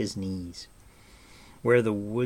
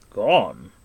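one loud, shocked word; alarmed question; 11 dB louder than usual. A narrator's reading. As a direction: snap it out in alarm. Gwydion alarmed by the news.

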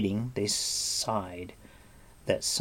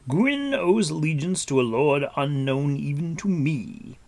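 higher, louder, sonorous character voice; Gwydion, grave warrior; 6 semitones higher, 5 dB louder than usual. A narrator's reading. Gwydion answering Taran about the Hunter, grave. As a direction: proclaim it like an old lord.

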